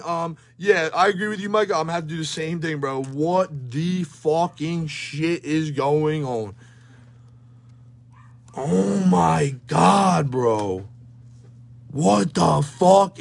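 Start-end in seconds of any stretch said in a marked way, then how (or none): 3.0-6.6 stunned slow disbelief. Real, not acted. Stunned by the pulls. 8.6-10.3 stunned exclamation. Real, not acted. Stunned by the pulls.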